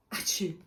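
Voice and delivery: loud acted sneeze. Jamie sneezes again.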